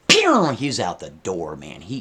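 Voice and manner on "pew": higher, louder zip sound effect; higher, louder cartoon zoom noise; mock whoosh sound. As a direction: fire off a quick getaway noise. Sound of him fleeing.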